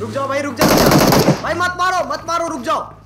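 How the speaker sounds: loud, high plea to hold fire; urgent shouting. Real, not acted. Player begging others not to shoot, urgent shout. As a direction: shout it urgently to stop them.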